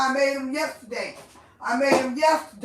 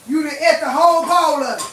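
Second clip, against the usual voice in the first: angry yell; 3 semitones higher, 9 dB louder, faster than usual. Real, not acted. Ranting at food thief.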